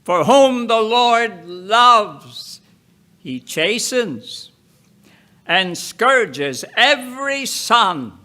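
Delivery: ordinary talk, nothing marked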